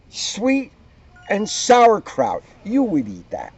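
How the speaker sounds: plain reading voice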